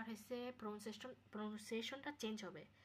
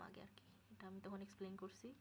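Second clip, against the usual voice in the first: whispered aside; 3 semitones lower, 11 dB quieter, faster than usual. Real, not acted. Muttered aside.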